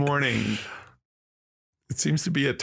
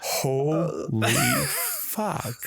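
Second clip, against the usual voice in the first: awed; 3 semitones higher, much slower than usual. Retold or imitated. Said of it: "And I'm like".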